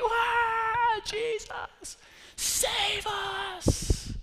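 a cartoonish whiny cry for help; mock wailing. Bored churchgoers begging Jesus to rescue them, mock wailing. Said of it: "And they're crying out".

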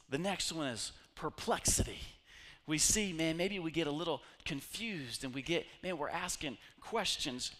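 ordinary talk, nothing marked

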